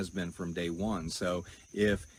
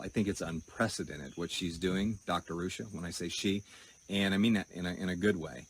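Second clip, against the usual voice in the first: ordinary talk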